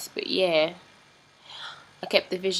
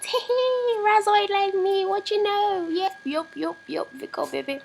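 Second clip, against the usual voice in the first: high, put-on British voice; attempted British accent, pitched up high; 14 semitones higher than usual. Vlogger clowning in a British accent. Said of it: "I am going to tell it in a British accent".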